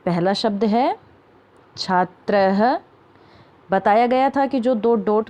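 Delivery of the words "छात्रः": छात्रः slowly, with a breathy "ha" echo at the end; образцовое произношение. Shown as a demonstration. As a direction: draw out छात्रः and sound the final visarga as "ha". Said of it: "खुद उच्चारण करके देखेंगे".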